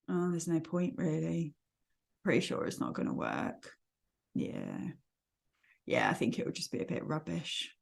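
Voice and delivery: dejected, low and soft, giving up; Eeyore. Acted. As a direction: sigh it out gloomily, voice sunk low. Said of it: "That sort of just dejected".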